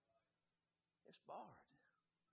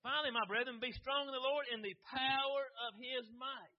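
scripture reading; 4 semitones higher, 6 dB louder than usual. Acted. Preacher reading scripture to congregation, proclaiming. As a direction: read the verse out with rising force.

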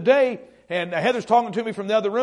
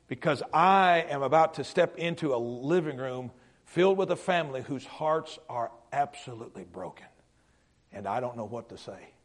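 hushed prayer; 7 semitones lower, 9 dB quieter than usual. Retold or imitated. Quiet prayer while driving at night.

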